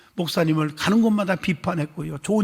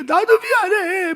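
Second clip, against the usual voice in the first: pastor's apology; 14 semitones higher, 4 dB louder, slower than usual. Retold or imitated. Pastor answering the weeping deacon.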